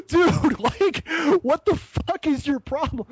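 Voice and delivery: high-pitched, half-laughing mock outrage; incredulous protest. Traveller to police in the interrogation room, exasperated mock protest.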